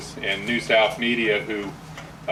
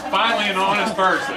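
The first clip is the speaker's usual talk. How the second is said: laughing; 9 semitones higher, faster than usual. Real, not acted. Laughing at a joke.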